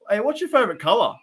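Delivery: high, bright mock-cheery question to a stranger. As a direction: pop the question up high and eager. Acting a random question to cashier.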